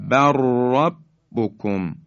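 slow barrabbukum, rolling the r in بر ربکم; lam merged into ra. Shown as a demonstration. Tajweed teacher repeating the merge, trilled r in بر ربکم. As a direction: join بر ربکم with one long doubled r.

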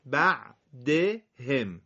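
بعدهم drawn out syllable by syllable; slow model reading. Shown as a demonstration. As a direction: spell out بعدهم slowly for the class. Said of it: "تکرار کنید". (آهسته و شمرده)

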